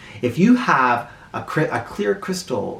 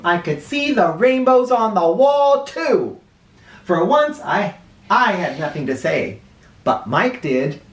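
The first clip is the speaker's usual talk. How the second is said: plain reading voice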